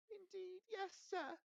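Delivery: very high, slow, tearful elf voice; a tiny servant sobbing out his plea; Dobby begs Harry, crying in a squeaky voice; weeping house elf. Acted.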